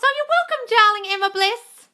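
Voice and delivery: high, loud, gushing thanks. Real, not acted. Thanking a grateful viewer by name, high and gushing. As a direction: gush your thanks to a fan.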